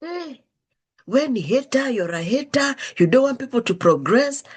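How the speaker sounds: hammed-up accusing hater rant; mock angry rant. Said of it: "he went on this rant".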